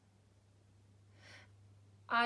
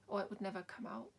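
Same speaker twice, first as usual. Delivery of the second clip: normal talking voice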